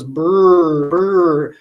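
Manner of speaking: slow rolled "brr, brr"; blended brr. Shown as a demonstration. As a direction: drag "brr" out as one blended sound. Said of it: "it blends together".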